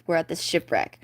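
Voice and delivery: usual speaking voice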